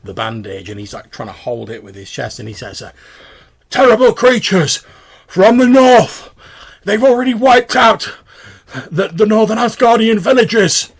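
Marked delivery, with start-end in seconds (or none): 3.7-10.9 desperate shouted report. Acted. Wounded stranger telling the guards the villages are gone, desperate shouting. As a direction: bellow the news of the massacre.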